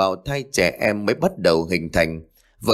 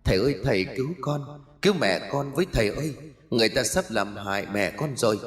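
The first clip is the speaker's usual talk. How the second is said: soft, quick plea in a small raised voice; pleading ghost child; 3 semitones higher, 5 dB quieter, much faster than usual. Acted. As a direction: beg for help as a ghostly child. (giọng trẻ con ma quái van xin khe khẽ)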